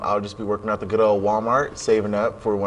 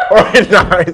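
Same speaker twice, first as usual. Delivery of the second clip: laughing; 7 semitones higher, 11 dB louder, slower than usual. Real, not acted. Stumbles over word, laughs.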